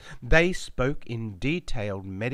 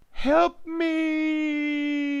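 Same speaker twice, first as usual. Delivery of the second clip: high, loud scream of help; 6 dB louder than usual. Acted. Narrator strapped down and hurt, screaming for help. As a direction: shriek it in panic. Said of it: "I screamed".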